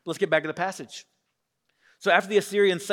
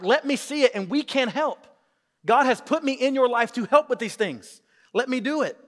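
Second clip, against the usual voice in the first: gentle coaxing; 7 semitones higher, 3 dB louder than usual. Retold or imitated. Dad coaxing his daughter.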